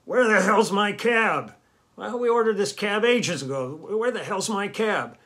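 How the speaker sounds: put-on impatient old man shouting at the front desk; angry old man yelling. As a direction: bark it out like a fed-up old guy demanding his cab. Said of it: "kind of pissed off, yelling, yelling at the girl".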